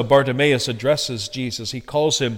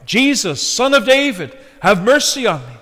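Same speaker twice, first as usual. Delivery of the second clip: higher, lifted pleading call; crying out; 5 semitones higher, 4 dB louder than usual. Retold or imitated. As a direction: voice the beggar's repeated cry. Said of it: "over and over again, Bartimaeus cried".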